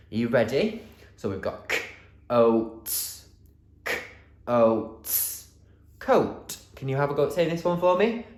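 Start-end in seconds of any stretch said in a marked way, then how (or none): 1.7-5.5 "k, oats" said as separate sounds with gaps; sounded out. Shown as a demonstration. Teacher showing children how to read coat, sounding out. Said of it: "sound buttons underneath to help us read". 6.0-6.6 "coat" blended into one whole word. Shown as a demonstration. Teacher showing children how to read, sounds blended together. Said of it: "Blend it together".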